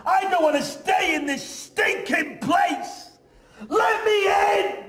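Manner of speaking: very high, loud, slow screamed plea; desperate shout. Acted. Trapped boy begging the townsfolk, high desperate shout. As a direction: scream it out as if locked out.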